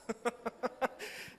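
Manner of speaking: high, airy chuckling; real run of voiceless chuckles; breathy laugh. Real, not acted. Preacher joking to congregation about strained patience, breathy laugh.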